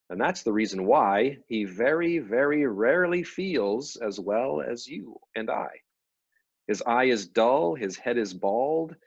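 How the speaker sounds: slow, sing-song poem recital; poem reading. Acted. Reading the Belloc poem aloud to her friend, slow rhyme recital. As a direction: read it out like a nursery rhyme, slowly. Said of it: "I can read it for us".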